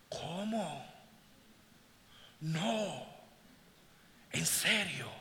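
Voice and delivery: soft, gasping disbelief; hushed and drawn out, barely believing it; stunned amazement. Acted. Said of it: "cuando algo pasa, uno hace". (asombro incrédulo, en voz baja)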